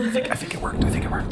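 hushed whisper, barely voiced. Real, not acted. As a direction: whisper so nobody hears. Sneaking into the house.

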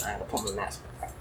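real offhand aside, barely audible; quiet aside. Real, not acted. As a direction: drop to a hushed, hurried aside. Aside while fixing the mask.

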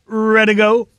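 ordinary talk

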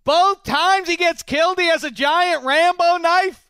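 high, loud and slow, hammering the point; excited emphasis. Real, not acted. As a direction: build it up, every word leaned on.

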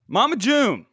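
high, loud cry of her name; loud exclaim. Real, not acted. Host calling out Mama June, loud and high. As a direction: belt out the name in disbelief.

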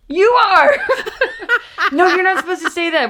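laughing. Real, not acted.

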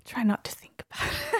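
choked, whispered and breaking; emotional whisper. Real, not acted. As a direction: hold back tears as you say it. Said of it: "I'm sorry".

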